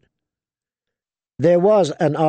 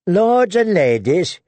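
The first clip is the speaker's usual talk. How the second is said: disgusted proclaim; 6 semitones higher, slower than usual. A narrator's reading. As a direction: spit out the titles like they taste bad. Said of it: "he proclaimed in a disgusted tone".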